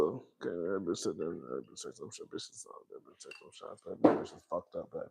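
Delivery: very soft and low, near whisper; hushed gossip. Real, not acted. Gossiping to viewers about Kenya, soft secretive murmur.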